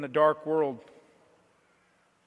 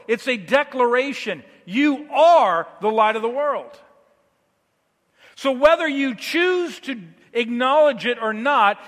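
louder, higher sermon litany, each you hammered; fervent preaching; 5 semitones higher, 5 dB louder than usual. Acted. Pastor calling each believer by name, fervent preaching. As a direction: preach the refrain like a drumbeat. Declaring the fact with force.

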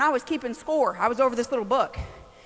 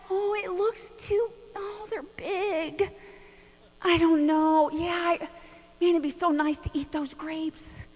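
timid passive whine; 4 semitones higher, 5 dB quieter than usual. Retold or imitated. Mocking timid believers, soft and wistful. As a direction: sigh at what you dare not take. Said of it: "passive Christianity that says".

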